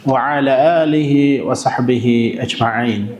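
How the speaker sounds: quick, low recited blessing before class; opening invocation. Acted. Teacher opening class with a routine Arabic blessing, quick and low. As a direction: rattle off the opening prayer in one breath.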